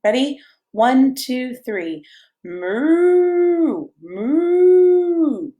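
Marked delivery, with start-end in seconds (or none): 2.4-5.5 long, higher, louder mooing; drawn-out playful moo; cow moo. Shown as a demonstration. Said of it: "Can you make a cow sound with me".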